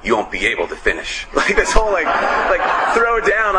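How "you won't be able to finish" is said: menacing cook. Retold or imitated. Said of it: "the guy's like".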